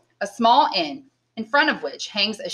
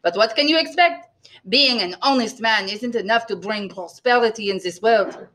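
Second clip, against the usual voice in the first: lightly voiced aggrieved innkeeper; complaining innkeeper; 2 semitones higher than usual. A narrator's reading. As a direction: grumble mildly with a put-on accent.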